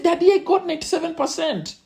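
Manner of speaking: put-on high, protesting boy's voice; pleading child. Boy pleading with his father over his grade, high.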